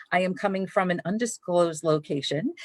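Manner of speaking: normal talking voice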